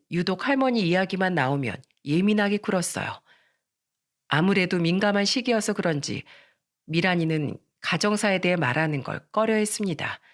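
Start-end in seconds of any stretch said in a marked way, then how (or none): none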